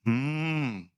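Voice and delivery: curt dismissive hum; mock the proud reply; proud hmm. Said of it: "घमंड तो देखो". (घमंडी हम्म की नकल)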